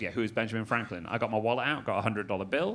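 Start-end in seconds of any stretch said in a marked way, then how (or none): 0.0-1.1 mild flat official. Retold or imitated. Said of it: "He goes".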